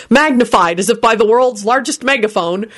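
ordinary delivery, nothing marked

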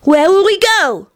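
put-on high, slow little-kid question; high, measured child voice; careful child. A narrator's reading. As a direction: ask deliberately like a small child. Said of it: "he enunciated carefully".

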